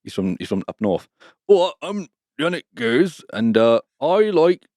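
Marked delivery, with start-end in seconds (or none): 1.5-4.6 slow, higher West Country drawl; hammed-up West Country yokel; mock West Country accent. To his co-host, a slow mock West Country accent. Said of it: "that's bloody West Country".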